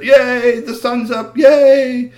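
high, loud cheering; cheering crowd. Acted. People on earth cheering the rising sun. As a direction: celebrate the sunrise out loud.

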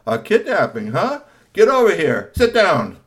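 loud barked command to sit; stern order. Acted. Darius ordering the two suspects, loud stern command.

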